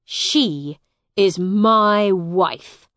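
loud, slow, weighty claim; angry possessiveness. A narrator's reading. Hugh claims Edith before Miles, angry. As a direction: stake your claim slowly and angrily. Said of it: "said Hugh angrily".

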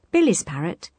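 usual speaking voice

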